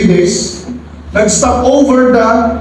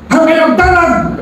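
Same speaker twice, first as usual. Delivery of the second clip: bold cry; 9 semitones higher than usual. Acted. Preacher voicing Moses' rallying cry.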